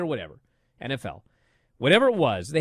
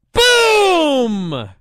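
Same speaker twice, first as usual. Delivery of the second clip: long, loud, high mock Boom; Big Boom AJ; 10 semitones higher, 8 dB louder than usual. Host imitates the wrestler's repeated catchphrase, drawn-out mock shout. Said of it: "And he goes".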